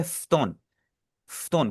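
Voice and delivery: फ्तोन with no vowel between f and t; फ्तोन, f straight into t; silent u. Shown as a demonstration. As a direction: say फ्तोन as fton, u skipped. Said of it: "ऊ को हमने यहाँ पे silent रखना है".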